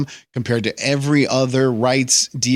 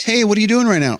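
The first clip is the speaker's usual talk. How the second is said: higher, louder casual caller checking in; 8 semitones higher, 3 dB louder than usual. Retold or imitated. Checking in on the supposed victim, higher casual phone voice. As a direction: sound breezy and unbothered on the phone.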